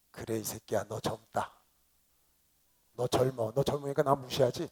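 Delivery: low, restrained mock scolding; glaring mother. Mother glaring at her son who shouted, imitated low reproach. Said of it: "딱 째려보시면서 뭐라고 알아요?".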